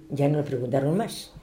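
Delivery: ordinary delivery, nothing marked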